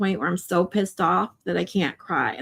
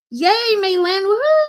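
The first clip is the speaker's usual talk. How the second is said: high, loud whooping cheer; 8 semitones higher, 5 dB louder than usual. Real, not acted. Streamer cheers for viewer Maylin in chat, high whooping. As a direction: cheer her on like a fan.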